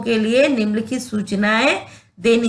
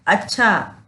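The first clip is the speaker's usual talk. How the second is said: ordinary talk, nothing marked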